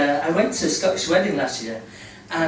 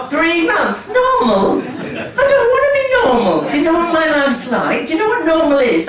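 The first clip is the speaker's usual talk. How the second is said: high, loud indignant protest; hammed-up outrage at the surgeon; mock outrage; 9 semitones higher, 8 dB louder than usual. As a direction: rant in pretend disbelief.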